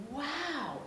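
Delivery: amazed high wow; breathless little gasp of wonder. Acted. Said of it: "gasped Peppa".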